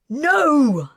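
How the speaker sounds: loud, high, desperate scream. A narrator's reading. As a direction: scream as the shot goes off. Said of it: "Kez screamed".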